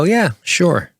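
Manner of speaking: high, breezy brush-off; mock dismissive. Imitating a casual brush-off of danger, high and breezy. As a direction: toss it off like nothing's wrong. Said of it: "Which normally you go".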